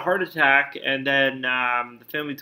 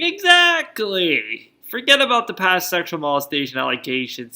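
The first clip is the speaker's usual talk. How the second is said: sarcastic exactly; 2 semitones higher, 4 dB louder than usual. Real, not acted. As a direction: stretch it out, dripping with sarcasm. Sarcastic agreement with scandal news.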